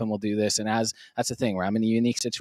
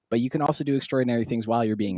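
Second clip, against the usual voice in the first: ordinary talk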